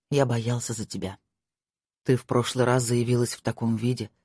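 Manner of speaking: a hint of a worried lover. Marko voiced by narrator.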